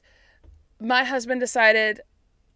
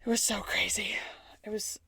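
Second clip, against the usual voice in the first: soft whispered awe; awed whisper; 8 dB quieter than usual. Real, not acted. Telling her cohost about the drive, whispered awe. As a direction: breathe it out in a whisper.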